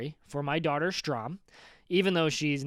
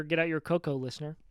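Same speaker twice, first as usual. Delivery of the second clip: ordinary talk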